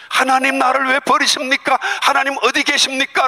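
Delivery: Jesus begging God why; pleading cry. Acted. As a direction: cry out as if abandoned.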